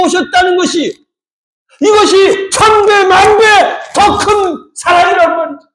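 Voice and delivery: high, slow emphatic preaching; impassioned preaching. Real, not acted. Preacher marvelling that the Creator came as man, impassioned. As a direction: preach with rising fervour, stretching key words.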